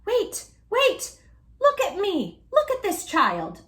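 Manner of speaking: high, loud excited cry; calling out to the crowd in amazement. A narrator's reading. Bat calls out excitedly.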